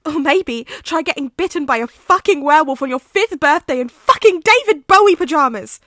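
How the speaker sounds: rising from bitter to all-out shouting; furious scream at a friend; furious outburst. Acted. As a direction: hurl it at him, building to a yell. Remus rages at Sirius.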